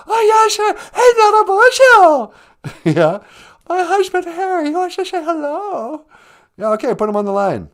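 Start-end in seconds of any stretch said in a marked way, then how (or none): 0.1-2.3 high falsetto wife calling out; loud high-pitched wife voice calling across the room. Acted. Wife voice calling Nana Boozhoo. 3.7-6.0 high falsetto wife calling out. Acted. Wife introducing husband Harry.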